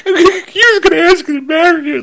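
high, cracking voice, half crying, half laughing; choked up. Retold or imitated. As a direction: voice breaking with emotion while retelling. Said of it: "I got a little choked up".

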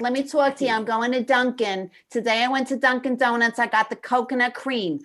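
a hint of a chatty Staten Island accent. Mimicking Amanda's food vlog in Staten Island accent. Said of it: "She's like".

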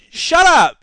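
loud, high-pitched shut-down; indignant retort. Acted. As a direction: shout them down, indignantly. Snaps back at quoted critics.

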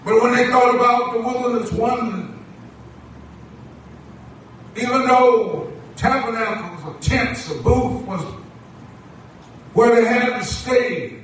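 projected sermon cadence in short, held phrases; preaching. Acted. Preacher assures the church that the Lord always provides, preaching. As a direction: stretch key words, then pause for the congregation.

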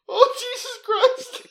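high, laughing exclamation. Real, not acted. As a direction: gasp it out mid-laugh. Still laughing hard.

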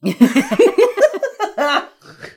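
high, loud cackle of laughter. Real, not acted. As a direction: crack up laughing. Laughing at joke.

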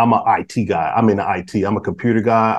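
ordinary talk, nothing marked

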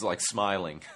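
ordinary talk, nothing marked

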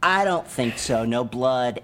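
ordinary delivery

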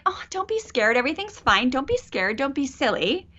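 high-pitched mock parental reassurance; brushing off fear. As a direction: imitate a parent brightly dismissing a child's fear. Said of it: "Scared was like".

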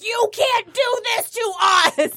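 high, slow outraged shout; outraged fan shout. Retold or imitated. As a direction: yell it like a betrayed fan. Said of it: "there was uproar 'cause we're like".

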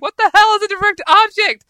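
loud, high mock outburst; retold shout of exasperation; exasperated yell. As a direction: yell it in hammed-up bafflement. Retells exasperated reply to teachers.

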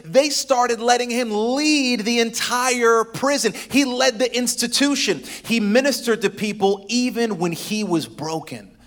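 loud, high preacher's build; preaching at full force. Acted. Pastor preaching to the congregation, full force. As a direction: preach it out to the church.

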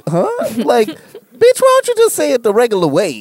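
high, incredulous mock annoyance; exasperated. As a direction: act fed up with her showing off. Annoyed reaction to girlfriend.

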